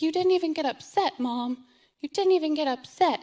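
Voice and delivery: put-on high, loud, upset teen wail; retelling her daughter's loud tearful complaint; daughter's tearful outburst. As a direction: cry it out at mom, hurt and accusing. Daughter crying, accusing mom.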